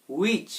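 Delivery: "হুইচ" said as "wich", no h; silent h. Shown as a demonstration. Teacher giving learners the correct form, "হুইচ" with silent h. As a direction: open "হুইচ" straight on a w. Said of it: "এটার উচ্চারণ তাহলে কি হবে".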